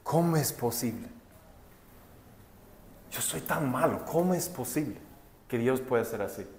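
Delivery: softly, as if near tears, retelling a sinner's amazement; a hushed, moved whisper of disbelief; quiet broken voice. Retold or imitated. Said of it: "comienza a llorar".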